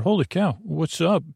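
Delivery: ordinary talk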